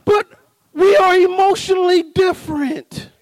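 normal talking voice

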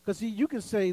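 ordinary talk

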